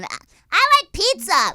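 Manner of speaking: put-on high, loud dopey kid; goofy kid voice. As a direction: blurt it like a clueless little kid. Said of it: "you need to calm down".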